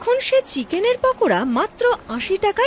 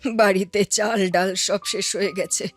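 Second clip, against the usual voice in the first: lower, aged auntie voice, kindly; old aunt voice; 7 semitones lower than usual. Acted. Old aunt advising Madhabi, lower aged voice. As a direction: speak as an old aunt to a young woman.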